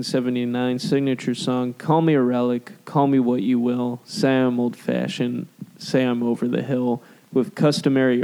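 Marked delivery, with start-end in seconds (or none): none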